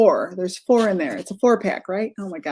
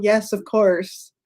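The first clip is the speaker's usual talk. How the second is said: ordinary talk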